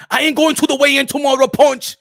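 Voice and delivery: emphatic boxer declaration; insistent repeat. Acted. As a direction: insist on it to a doubting friend. Repeats it louder when questioned.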